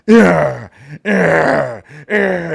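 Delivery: loud, high grunted yeahs with each punch; punching grunts. Retold or imitated. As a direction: bark it out like you're smashing tapes. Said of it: "I used to just do like this and I punch through 'em".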